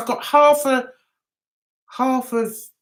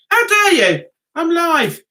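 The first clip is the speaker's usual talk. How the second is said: high, louder mock outrage; mock indignation; 5 semitones higher, 3 dB louder than usual. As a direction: playfully scold an interrupter, high-pitched. Scolds whoever interrupts the live stream.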